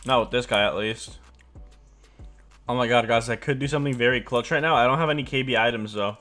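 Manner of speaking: ordinary delivery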